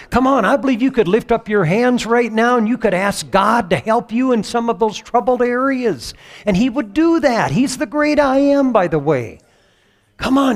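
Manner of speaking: loud, high preaching at full force; preacher building to an ecstatic peak; fiery preaching. Real, not acted. Pastor stirring the congregation, preaching loud and high.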